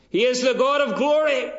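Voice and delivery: loud, high ringing proclamation; full-force preaching. Acted. Preacher proclaiming God's glory to the congregation, loud and high. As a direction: preach it at the top of your voice.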